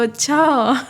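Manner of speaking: अच्छा with mild shocked fall; a hint of shock on अच्छा. Shown as a demonstration. Teacher shows shocked अच्छा after an accident. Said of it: "शौक में, शौक वाला अच्छा।".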